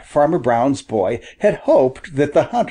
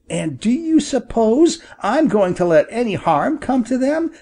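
put-on higher, heated boy's voice; indignant boy; 6 semitones higher than usual. A narrator's reading. Boy lecturing the hunter about the bad law, indignant. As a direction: scold the hunter with rising indignation.

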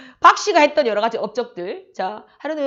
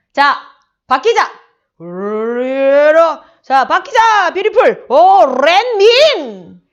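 주문 외우는 흉내; 3 semitones higher, 9 dB louder, much slower than usual. Retold or imitated.

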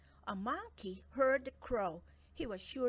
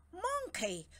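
stern king; 10 semitones higher than usual. Acted. King summons monkey.

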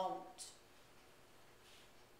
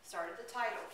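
normal talking voice

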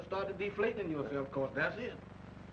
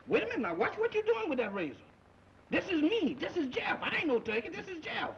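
panicked pleading; 8 semitones higher than usual. Acted. To the sleepwalking barber holding a razor, frantic high pleading. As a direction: beg for your life, voice jumping high.

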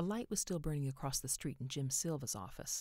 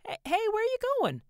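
calling after; 11 dB louder than usual. Acted. Calling after man running off.

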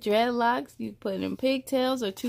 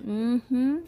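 usual speaking voice

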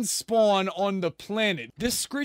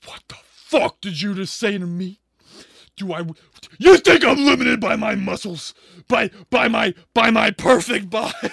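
mock offended outrage; 8 dB louder than usual. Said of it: "I'd be like".

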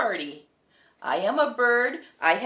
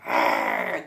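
low imitation tiger growl; deep big-cat rumble. Shown as a demonstration. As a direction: growl like a tiger for the children to copy. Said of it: "Show me that".

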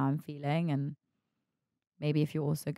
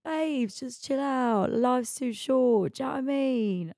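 higher, slow matey cabbie reassurance; Essex cab driver; 7 semitones higher, slower than usual. Retold or imitated. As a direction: soothe her like an Essex cabbie. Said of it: "this Essex guy".

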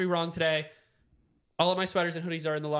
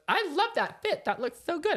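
put-on gushing girlfriend; 10 semitones higher than usual. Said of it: "Kate goes".

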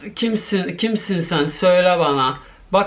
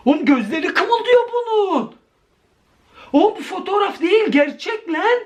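alarmed; 9 semitones higher than usual. Real, not acted. Eyes move in the photo.

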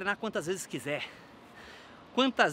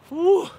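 effort shout; 11 semitones higher, 10 dB louder than usual. Real, not acted. Loud cry of effort after training.

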